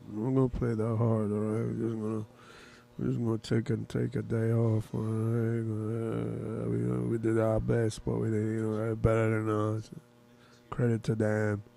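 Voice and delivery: low, quiet, droning monotone; Gilmore voice. Acted. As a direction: mumble it low and even, barely any energy. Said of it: "with my Gilmore voice".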